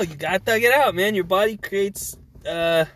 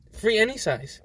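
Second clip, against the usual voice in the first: ordinary delivery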